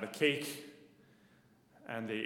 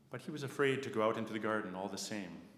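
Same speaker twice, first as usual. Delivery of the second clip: usual speaking voice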